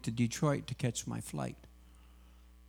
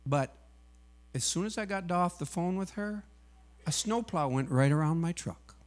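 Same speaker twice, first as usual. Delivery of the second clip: usual speaking voice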